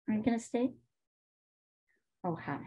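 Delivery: ordinary delivery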